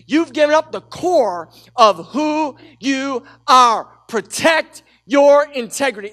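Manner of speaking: preaching shout. Real, not acted. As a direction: drive it home to the congregation. Preacher driving home the point.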